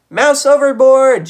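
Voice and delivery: very high, loud, drawn-out alarm; shriek. A narrator's reading. Thea seeing Geronimo fall overboard, shrieking. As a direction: scream it across the deck. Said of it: "she shrieked".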